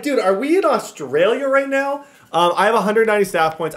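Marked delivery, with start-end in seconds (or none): 0.0-2.1 high, loud, disbelieving question; a playful dude, where are we; mock surprise. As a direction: act stunned, half-joking. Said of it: "I was like".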